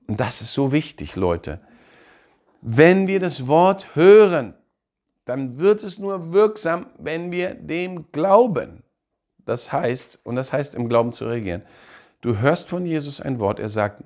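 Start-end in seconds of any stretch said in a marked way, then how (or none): 2.6-8.8 high, slow, weighty; emphatic preaching. Real, not acted. Preacher driving home that faith makes the Word work, slow and emphatic. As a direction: lean hard on each phrase. Key point of sermon.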